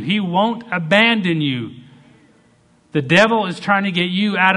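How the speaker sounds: loud, high preaching build; full-force pulpit climax; fiery preaching. Acted. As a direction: preach it hard and rising.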